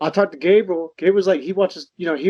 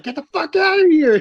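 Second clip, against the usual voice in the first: high, louder mock yell; 9 semitones higher, 4 dB louder than usual. Quoting the father yelling at his son, high mock yell. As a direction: shout it like chasing a kid off. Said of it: "the part where you're like".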